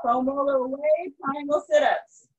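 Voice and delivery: calling out. Real, not acted. Calling out workout moves.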